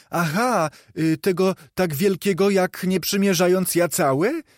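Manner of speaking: put-on a street boy puzzled by the question; boy's voice. A narrator's reading. Boy in the street answering Scrooge, lightly voiced.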